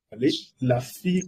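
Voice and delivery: ordinary talk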